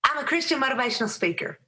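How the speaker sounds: high, fast, hurried reply; her own reply. Retold or imitated. Speaker answering her seatmate, high and hurried. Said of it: "I said".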